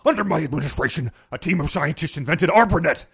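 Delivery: Nixon impression. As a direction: loosen the jowls, move the head, Nixon. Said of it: "just loosen those jowls".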